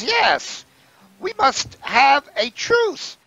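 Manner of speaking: very high goblin voice; stretched, high-pitched goblin grievance; goblin leader. Acted. Goblin leader complaining about kobolds, very high-pitched voice. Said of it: "He says".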